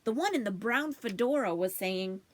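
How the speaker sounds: plain reading voice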